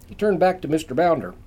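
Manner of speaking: ordinary delivery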